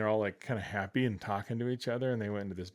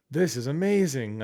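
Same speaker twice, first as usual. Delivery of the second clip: higher, louder retold thrill; amazed delight; 4 semitones higher, 7 dB louder than usual. Design student discovering ActionScript, amazed delight.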